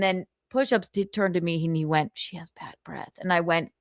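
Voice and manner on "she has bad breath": hushed aside to a friend; whisper. Retold or imitated. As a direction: drop to a near-whisper so she won't hear.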